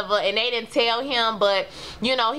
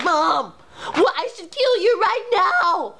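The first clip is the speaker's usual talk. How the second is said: higher, slow, hammed-up threat to mom; mock threatening daughter; 8 semitones higher, slower than usual. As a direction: ham up a daughter threatening to kill her mother. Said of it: "when she was threatening her mama".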